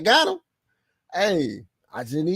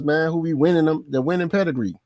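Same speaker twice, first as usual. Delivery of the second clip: ordinary delivery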